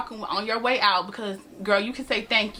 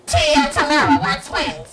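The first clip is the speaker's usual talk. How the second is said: Tia Tamara callout; 5 semitones higher, 7 dB louder, slower than usual. Pretend. Calling out twin catchphrase.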